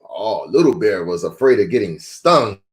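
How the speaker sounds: usual speaking voice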